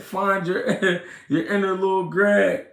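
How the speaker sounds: reading through real chuckles; laughing. Real, not acted. Reading a viewer's comment back, laughing. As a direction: let laughter break up the words.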